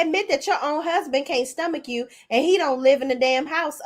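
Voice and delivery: normal talking voice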